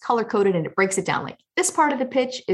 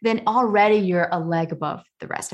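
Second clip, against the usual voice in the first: usual speaking voice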